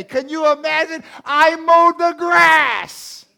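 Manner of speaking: can you believe it, cry it out; thrilled preacher marvelling at mowing in November; amazed excitement. Real, not acted.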